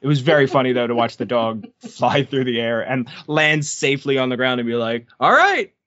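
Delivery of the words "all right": high, bouncy voice for the happy dog; carefree pup; cheerful dog voice. Pretend. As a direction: play the dog, pleased with its flight. Voicing the dog after landing.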